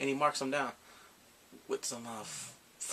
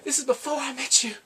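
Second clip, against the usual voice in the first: defensive excuse; 9 semitones higher, 6 dB louder than usual. Retold or imitated. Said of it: "me, I'm like".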